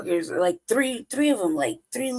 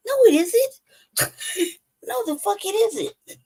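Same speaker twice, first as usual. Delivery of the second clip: much higher, louder, slowly stretched denial; drawn-out indignant no; 13 semitones higher, 3 dB louder, much slower than usual. Real, not acted. Rejecting the neighbors' stingy pay, high and drawn out. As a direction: stretch the no out in outrage.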